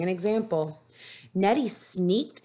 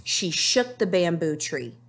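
ordinary delivery

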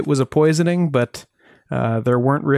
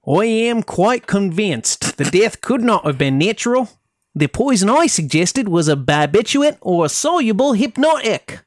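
higher put-on British accent, drifting into Cockney; Cleland's British accent; 6 semitones higher than usual. Host quoting pathologist Cleland's report, in a put-on British accent. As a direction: read the pathologist's quote in a wobbly British accent. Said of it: "Got, got back into Cockney at the end".

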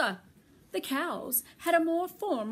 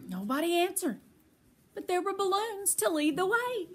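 plain reading voice

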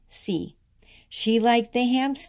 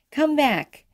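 ordinary delivery

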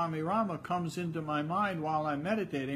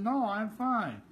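grumpy denial; 7 semitones higher, slower than usual. Retold or imitated. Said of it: "And I said".

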